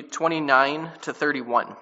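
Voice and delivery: ordinary talk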